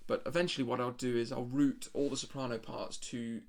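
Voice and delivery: normal talking voice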